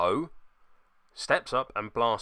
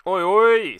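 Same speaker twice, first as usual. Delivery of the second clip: loud Cockney "Oi, oi"; Cockney alarm; 8 semitones higher, 12 dB louder than usual. Acted. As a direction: shout it like a London geezer. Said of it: "who apparently is Cockney".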